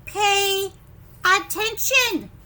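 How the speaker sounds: loud, slow command; teacher snapping at a daydreamer; stern order. Acted. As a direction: snap him back to attention. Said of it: "his teacher is saying".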